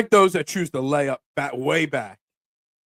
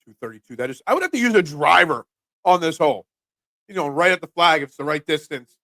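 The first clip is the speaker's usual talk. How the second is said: ordinary delivery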